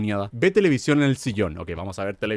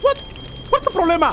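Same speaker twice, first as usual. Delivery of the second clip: indignant outburst. Real, not acted. Reacting to fire alarm.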